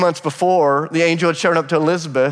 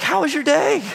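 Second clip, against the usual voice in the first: much higher, bright mock parent voice; parents asking; 11 semitones higher than usual. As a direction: play the cheery parent at dinner. Parents voiced in retold scene.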